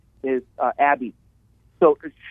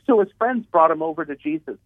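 normal talking voice

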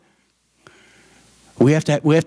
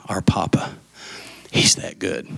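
whisper; 5 semitones lower, 4 dB quieter than usual. Real, not acted.

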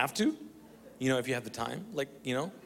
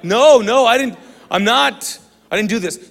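shouting no, no, much louder and higher; loud denial; 8 semitones higher, 12 dB louder than usual. Retold or imitated. Panicked denial to strangers who think he did it, loud. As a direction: protest your innocence loudly. Denying blame to staring strangers.

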